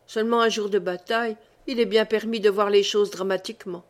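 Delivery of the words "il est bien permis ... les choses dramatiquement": ordinary delivery, nothing marked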